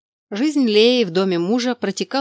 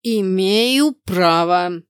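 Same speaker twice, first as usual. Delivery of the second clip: put-on slow, loud drunken slur; drunk with hiccups; 5 dB louder, much slower than usual. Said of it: "пьяно икнул Кнут".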